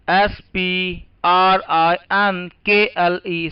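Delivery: each letter set apart, very slow; slow spelling. Shown as a demonstration. Teacher dictating spelling to the class, very slowly. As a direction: spell it out letter by letter for note-taking. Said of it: "दूसरा लिखिए".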